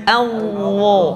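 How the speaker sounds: ALLAH with a buzzed, rounded heavy middle; thick zo. Shown as a demonstration. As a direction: raise the tongue to darken ALLAH. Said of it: "Naik, naik lidahnya". ("Allah" tebal, lidah naik)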